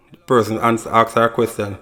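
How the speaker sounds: ordinary talk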